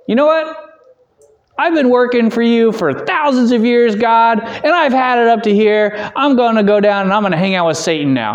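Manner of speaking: hammed-up rebellious angel; fed-up angel. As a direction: play an angel quitting in a huff. Imagined angel quitting heaven.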